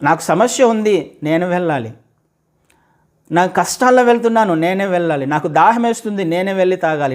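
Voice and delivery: loud, high, driving sermon voice; fiery preaching. Acted. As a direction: preach it out to the whole congregation. Preaching at full force. (గట్టిగా ఉద్వేగంగా బోధించడం)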